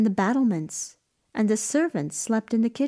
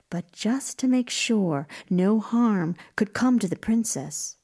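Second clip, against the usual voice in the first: plain reading voice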